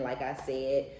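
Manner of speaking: ordinary talk, nothing marked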